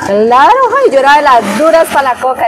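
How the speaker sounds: proud, thrilled boast, voice leaping up; excited exclamation. Real, not acted. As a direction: burst out loud and high with delight. Excited boast about favourite game. (exclamación entusiasmada)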